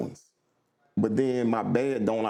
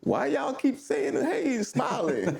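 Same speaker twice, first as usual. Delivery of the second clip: higher, slow, puzzled why y'all keep saying hey; baffled newcomer; 11 semitones higher than usual. Retold or imitated. Mock-baffled city couple facing smiling strangers, high and slow. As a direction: act confused by all the smiling. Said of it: "You looking at people like".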